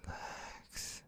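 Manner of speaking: soft whispered relax to himself; self-calming whisper. Real, not acted. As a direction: talk yourself down in a hush. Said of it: "calming himself down".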